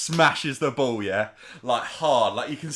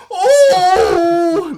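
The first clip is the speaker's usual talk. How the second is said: very high, loud cry of pain; pained yelp; 15 dB louder than usual. Retold or imitated. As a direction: shriek like a stubbed foot. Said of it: "and he's like".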